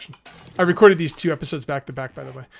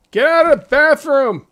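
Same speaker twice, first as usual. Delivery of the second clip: angry yell; 9 semitones higher, 10 dB louder than usual. Acted. Yelling at zombie in bathroom.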